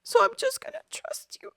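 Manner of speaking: high, emotional pleading prayer; tearful plea. Retold or imitated. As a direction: plead with God, voice lifted close to tears. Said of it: "that's when I start crying".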